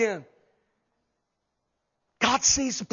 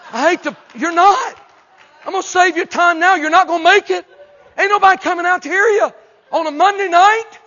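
loud, high-pitched comic shouting; blunt mock rebuke; 9 semitones higher, 11 dB louder than usual. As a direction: burst out with a hard truth, much higher and louder. Blunt retort to would-be evangelist.

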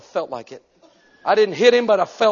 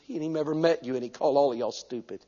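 ordinary delivery

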